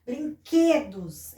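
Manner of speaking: "Brinquedos" slowly, stretching QUE; "brin-QUE-dos", drawn out; strong syllable shown. Shown as a demonstration. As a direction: sound out "Brinquedos" with weight on QUE. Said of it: "é o quê".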